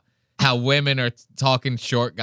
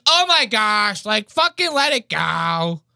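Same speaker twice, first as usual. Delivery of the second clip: high-pitched mock exasperation, slowing at the end; imitated exasperated girl; 9 semitones higher, slower than usual. Imitating a girl heckling the comedian, exasperated and high. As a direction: mimic a fed-up audience member, pitched up. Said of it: "You hear the girl?".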